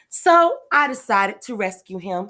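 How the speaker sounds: ordinary delivery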